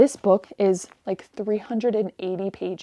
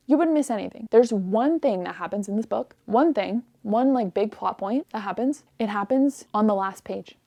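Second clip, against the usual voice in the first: ordinary talk, nothing marked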